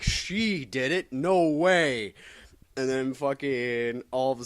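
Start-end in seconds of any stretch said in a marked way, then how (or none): 0.0-2.1 slow, high shocked gasp; hammed-up disbelief; mock shock. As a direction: play it up like a stunned character. Retelling a character's shocked line.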